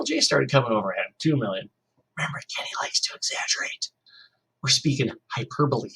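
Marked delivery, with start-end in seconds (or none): 2.2-5.9 whispered aside. Real, not acted. Teacher stepping out of the story to whisper a hint to students.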